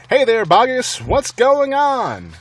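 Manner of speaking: high, loud, slow jeering call. Acted. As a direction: call down mockingly from the rim. Villagers calling down into crater.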